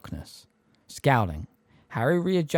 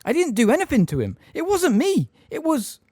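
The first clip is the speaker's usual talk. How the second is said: insistent denial; 6 dB louder than usual. A narrator's reading. Harry denying desperately.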